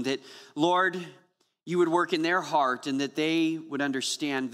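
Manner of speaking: earnest spoken prayer to God. Real, not acted. Pastor leading the congregation in prayer, earnest. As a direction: pray it humbly, unhurried.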